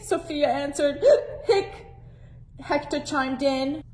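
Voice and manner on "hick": hiccup sounds, very high; squeaky hiccups. Acted. Hector hiccups.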